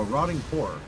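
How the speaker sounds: usual speaking voice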